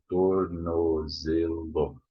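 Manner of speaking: "Tornozelo" drawn out in separate syllables; slow careful demo. Shown as a demonstration. Teacher repeating a hard word for students, slow careful demo. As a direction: spell out "Tornozelo" slowly by syllable. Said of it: "This is not easy".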